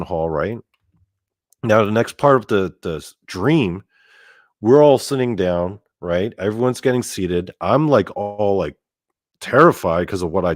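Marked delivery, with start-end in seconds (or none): none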